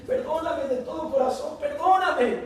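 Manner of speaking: high, urgent plea for forgiveness; pleading. Acted. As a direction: beg with everything you have. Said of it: "se postra a sus pies y dice".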